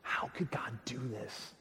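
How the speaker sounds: livid. Retold or imitated. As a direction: swallow the rage, barely voiced. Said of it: "He is livid".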